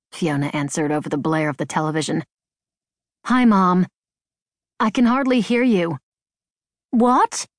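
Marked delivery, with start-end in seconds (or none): 3.3-6.0 raised, louder voice over background noise. A narrator's reading. Daughter phoning mother over a blaring television, raised voice. 6.9-7.5 high, louder "what?"; raised questioning call. A narrator's reading. Mother on the phone over a blaring television, raised questioning voice.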